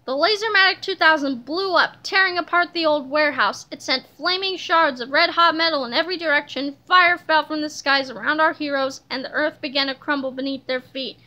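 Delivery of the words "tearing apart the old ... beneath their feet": ordinary delivery, nothing marked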